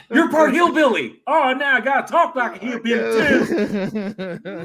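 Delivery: high and loud, laughing through a mock quote. Podcaster mocking the movie's twist, laughing and high. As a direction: cackle it out while mocking the plot.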